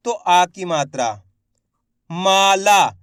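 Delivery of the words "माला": माला with मा and ला each stretched long; drawn-out vowels. Shown as a demonstration. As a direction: dictate माला slowly, holding both aa sounds. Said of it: "ला का उच्चारण भी खेच को रहा है".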